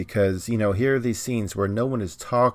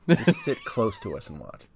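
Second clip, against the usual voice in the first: laughing; 6 semitones higher than usual. Acted. Co-host continues bit, laughing.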